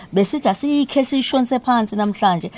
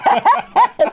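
loud laugh; 8 semitones higher, 4 dB louder than usual. Real, not acted. Host laughs at guest's reply.

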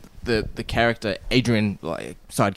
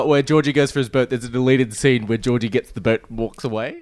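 ordinary talk, nothing marked

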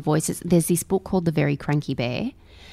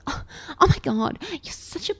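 scornful sibling; 10 semitones higher than usual. Retold or imitated. Retold sibling mocking younger one.

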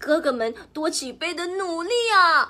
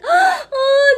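startled gasp; 10 dB louder than usual. A narrator's reading. Wolf suddenly appears.

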